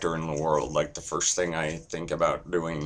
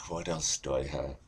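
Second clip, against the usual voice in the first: usual speaking voice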